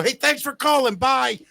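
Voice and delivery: high-pitched, dismissive sign-off; mock-cheery brush-off. Host hanging up on a late caller, mock-cheery.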